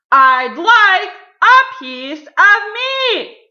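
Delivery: slow, booming high shout; Mister Noisy. A narrator's reading. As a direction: holler each word at full blast. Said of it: "Mister Noisy shouted".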